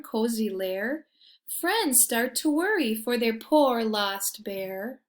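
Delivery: lively storybook reading; reading to children. A narrator's reading. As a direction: read it like a bedtime picture book. Reading picture book aloud.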